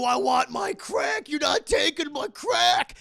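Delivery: loud, slow, growled junkie yell; crackhead thug voice. Retold or imitated. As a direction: bellow like a crazed addict guarding his stash. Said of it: "and he's like".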